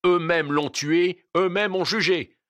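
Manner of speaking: weighty accusing call; powerful voice. Acted. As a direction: declare it high and strong over a crowd. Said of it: "murmura une voix puissante".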